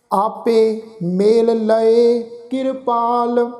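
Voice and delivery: slow, drawn-out sacred recitation; scripture recitation. Acted. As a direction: recite the verse slowly, stretching the vowels.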